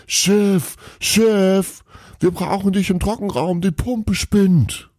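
high calling across a hall; shouting. A narrator's reading. Elf calling Santa across the hall, urgent shout. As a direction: call out urgently to the boss. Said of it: "brüllte einer".

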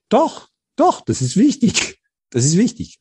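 high, urgent "doch, doch", pressing the point; emphatic insistence. Retold or imitated. His inner voice insisting against the warnings, urgently. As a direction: insist fervently, as if arguing with yourself.

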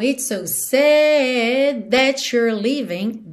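slow line with "sad" held long; SAD drawn out. Shown as a demonstration. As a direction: linger on the vowel of "sad". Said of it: "Esse sad fica alongado".

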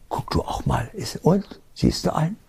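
put-on a soft breathy hush, then rising like asking a small child; hushed coaxing mother. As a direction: coax the child to look, half whispered. Mutter sucht mit Kind Geister. (Mutter flüstert dem Kind lockend zu)

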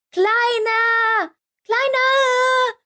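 hoch gerufen, suchend; Rufen. Acted. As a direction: ruf besorgt nach dem Kleinen. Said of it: "Sie riefen". (die Wichtel rufen besorgt nach dem Kleinen)

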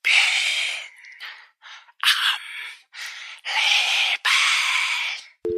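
creepy caller whispering with long gaps. A narrator's reading. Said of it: "die Flüsterstimme". (unheimliches Flüstern mit langen Pausen)

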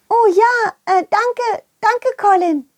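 very high, happy little creature; high Schwubi voice. Acted. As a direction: thank him as a tiny creature. Schwubis thank Colin.

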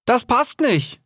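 high-pitched protest; calling out. A narrator's reading. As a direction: call out the objection. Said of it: "rief Rix". (rufend, mit hoher Stimme)

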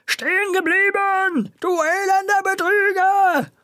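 high, slow put-on grocer voice, furious; yelling after the fleeing thief; furious shout. A narrator's reading. Angry grocer calling after the fleeing boy, high and slow. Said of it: "rief der erzürnte Herr Vegettini".

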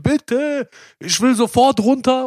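high, pleading panic, slowed on the plea; retold panicked yell. Retelling his terror in the gondola to his friend, high panicked begging. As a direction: beg to get off the gondola like it's life or death. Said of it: "und so geschrien".

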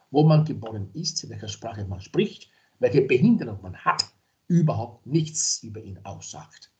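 ordinary delivery

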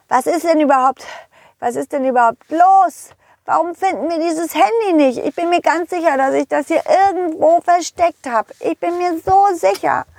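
put-on high, whiny child voice; exasperated little pirate. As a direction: insist like a puzzled, frustrated little boy.

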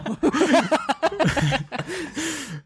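real laugh breaking out high; high delighted laughter; high burst of laughter. Real, not acted. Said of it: "laughing at Oranienburg mix-up".

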